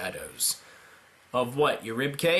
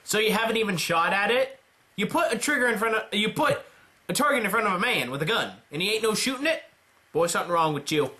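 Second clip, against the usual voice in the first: country drill sergeant; 7 semitones higher, faster than usual. Pretend. Mocking gruff drill-sergeant type.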